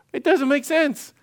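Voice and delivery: high, incredulous "It doesn't make sense"; incredulous Gideon. Retold or imitated. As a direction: Gideon baffled at his orders. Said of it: "He's like".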